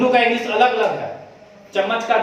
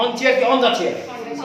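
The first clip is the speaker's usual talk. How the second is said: "on chair" and "on the chair" spoken slowly and deliberately side by side; slow demo; 3 semitones higher, much slower than usual. Shown as a demonstration. Teacher offering two phrasings, drawn out slowly. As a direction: lay out both options slowly so the ear can compare. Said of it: "अब बोल के देखो".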